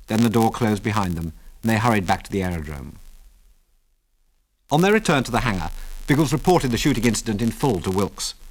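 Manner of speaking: usual speaking voice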